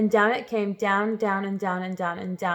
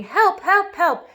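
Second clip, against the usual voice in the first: high, loud cries of help; desperate high cries for help; calling for help; 10 semitones higher, 6 dB louder, much slower than usual. Acted.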